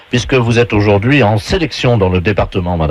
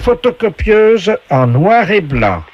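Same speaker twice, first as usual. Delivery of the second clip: high, slow showman voice reading the item; game show announcer voice; 7 semitones higher, slower than usual. Pretend. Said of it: "la voix du jeu".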